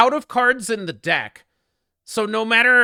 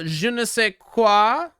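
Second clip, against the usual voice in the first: slow, lightly mock French je ne sais quoi; slower than usual. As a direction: ham up the French phrase. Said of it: "In French".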